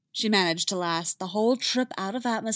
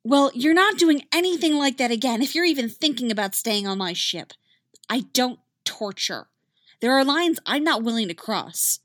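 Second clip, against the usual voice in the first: louder stern warning; firm; 6 semitones higher, 6 dB louder than usual. Acted. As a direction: lay down the line. Ahsoka laying down rules.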